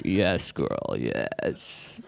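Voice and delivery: deep, quiet creepy voice, last word breathed; creepy low "Yes, girl". Retold or imitated. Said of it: "it starts to sound really creepy".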